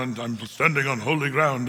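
a lightly voiced epic-film Moses, low; low, grand movie-hero delivery; hint of Charlton Heston's solemn boom; Charlton Heston impression. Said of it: "Remember Charlton Heston walking up".

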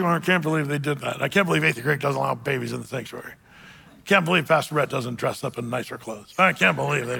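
quick, soft mock grumbling of a churchgoer; grumbling under breath. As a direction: mutter the complaints fast and low under your breath. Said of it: "it's under your breath".

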